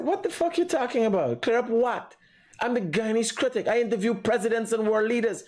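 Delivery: indignant retort. Retold or imitated. Retelling the critic's reply to a peace offer, indignant. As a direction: act out an affronted brush-off.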